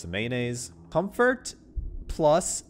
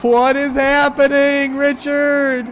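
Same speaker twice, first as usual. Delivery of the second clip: freaked out; 12 semitones higher, 11 dB louder, slower than usual. Real, not acted. Freaked out, slow and loud.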